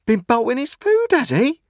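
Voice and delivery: very high, loud cry. A narrator's reading. Sister pouncing on news of the boy's bolting, crying out. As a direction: cry it out as a sharp accusation. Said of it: "cried my sister".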